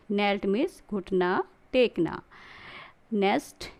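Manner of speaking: ordinary talk, nothing marked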